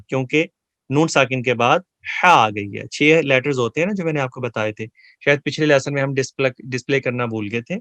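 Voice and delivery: usual speaking voice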